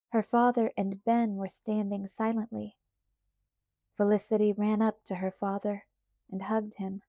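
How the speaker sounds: ordinary delivery, nothing marked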